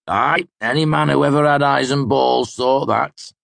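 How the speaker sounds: a tipsy man setting him straight; drunken amused mercenary. Acted.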